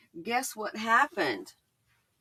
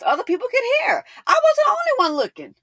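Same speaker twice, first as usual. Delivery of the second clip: excited; 6 dB louder, faster than usual. Real, not acted. Stressing how loud he was.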